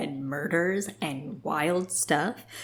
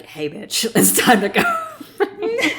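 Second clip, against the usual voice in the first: laughing mock wake-up call; 12 semitones higher, 6 dB louder, much slower than usual. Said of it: "being like".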